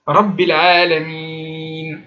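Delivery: Rabbil Alamin very slow, light r, doubled b; slow gentle recitation; light subtle r. Shown as a demonstration. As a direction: let the r touch lightly, lean on the shadda. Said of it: "c'est léger, c'est subtil".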